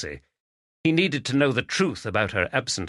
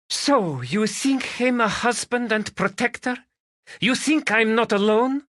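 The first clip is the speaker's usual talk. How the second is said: put-on higher, heavier Spanish accent, voice turning on him; thicker Spanish accent; 13 semitones higher, 3 dB louder than usual. Magdalena challenging Stephen about her husband, in a thickening Spanish accent. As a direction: lean harder into the Spanish accent, bristling. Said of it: "Her accent thickened".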